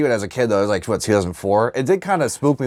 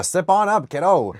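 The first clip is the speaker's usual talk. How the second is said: lightly voiced high carny; carnival barker; 8 semitones higher, slower than usual. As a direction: call out like a fairground barker. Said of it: "Carnival Barker".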